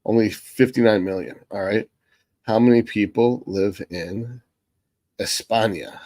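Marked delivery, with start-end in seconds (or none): 2.4-6.0 España said the Spanish way, eh-SPAH-nya; Spanish pronunciation. Real, not acted. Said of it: "saying Spanish name for Spain".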